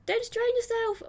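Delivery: put-on high girlish voice calling after someone; Monika calling out. A narrator's reading. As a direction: call out lightly in a high, put-on voice. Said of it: "Monika calls out after me".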